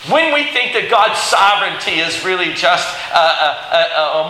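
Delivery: normal talking voice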